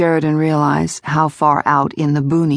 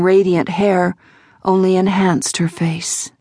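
usual speaking voice

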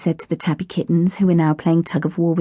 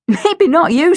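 laughing; 9 semitones higher, 5 dB louder, slower than usual. A narrator's reading. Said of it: "she laughed".

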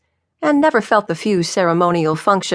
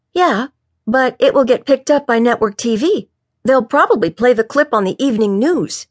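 higher, excitable underling voice; eager officer, insistent; 7 semitones higher than usual. Acted. Officer Dan tells the chief about network coverage, eagerly.

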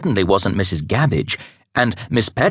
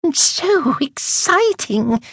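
high, slow, beaming gush; gushing delight; much slower than usual. Acted. Miss Scurrilous gushing about the party, high and delighted. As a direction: swoon over the news. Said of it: "beamed with pleasure".

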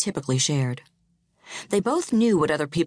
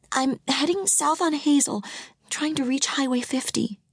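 anxious; 10 semitones higher than usual. A narrator's reading. Panicked wife reports location.

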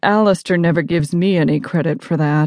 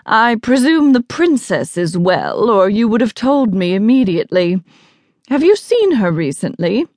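higher, imperious formal questioning; haughty guest, inquiring; 4 semitones higher than usual. Acted. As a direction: question the boy loftily. Voices the arrogant guest.

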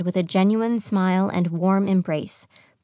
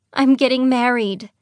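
higher, delighted reveal; thrilled, pitched up; excited announcement; 5 semitones higher, 4 dB louder than usual. A narrator's reading. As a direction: burst out with happy news. Stella announces her good news.

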